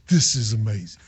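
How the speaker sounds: low, quiet put-on voice; Milan impersonation. Imitating a player looking up at the crowd, low impersonation. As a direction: drop low into another man's voice. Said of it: "that's my Milan impersonation".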